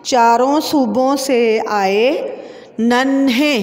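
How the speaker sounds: slow, syllable by syllable; slow dictation reading. Shown as a demonstration. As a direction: read it out slowly for the class. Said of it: "अब हम".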